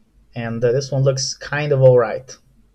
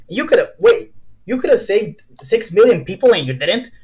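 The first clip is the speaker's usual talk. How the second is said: louder, higher accusing outburst; outraged; 6 semitones higher, 5 dB louder than usual. Pretend. He accuses the helicopter of letting it happen, louder and higher. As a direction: confront him, appalled and louder.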